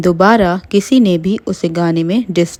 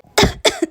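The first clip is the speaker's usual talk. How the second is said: breathy cough. Acted. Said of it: "Meenal खांसते हुए".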